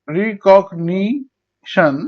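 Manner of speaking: Recognition very slowly in four beats; long gaps between syllables of recognition. Shown as a demonstration. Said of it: "চার ভাগে".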